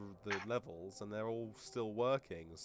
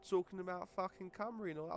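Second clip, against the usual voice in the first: ordinary delivery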